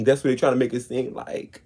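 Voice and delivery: usual speaking voice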